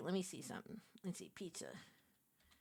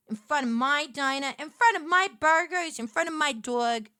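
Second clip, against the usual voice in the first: mock betrayal; 3 semitones higher, 8 dB louder than usual. Real, not acted. Mock betrayal over pizza game.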